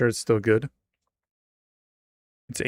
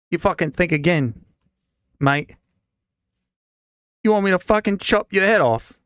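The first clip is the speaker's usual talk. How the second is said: put-on loud, rough British dwarf accent, higher; a brawling British dwarf bellowing threats; British bruiser shouting, pitched up; 3 semitones higher, 5 dB louder than usual. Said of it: "Apparently he was British. He'd just been faking his American accent".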